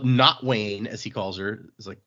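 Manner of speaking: ordinary talk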